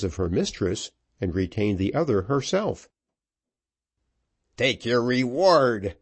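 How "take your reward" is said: slow, drawn-out threat; mildly raised, deliberate angry cry; furious cry. A narrator's reading. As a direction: cry it out slowly as you attack. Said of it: "cried Vanslyperken".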